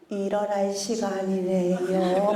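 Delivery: calm, unforced morning voice; gentle wake-up. Shown as a demonstration. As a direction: coax the child awake tenderly. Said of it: "어마어마한 이게 숙련이고".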